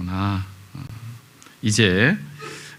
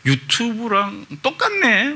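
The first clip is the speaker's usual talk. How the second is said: high, slow child voice mimicking the daughter; high-pitched kid voice, slow and amused; daughter's amazed quip; 13 semitones higher, slower than usual. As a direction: squeal it like a delighted little girl. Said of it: "그럼".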